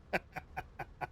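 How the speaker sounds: quiet chuckle in short puffs; soft chuckle. Real, not acted. After botching an English accent, soft chuckle. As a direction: laugh softly at yourself. Laughs at own bad accent.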